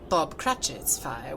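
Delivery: ordinary delivery, nothing marked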